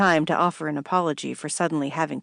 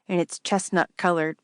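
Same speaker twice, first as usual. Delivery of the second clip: plain reading voice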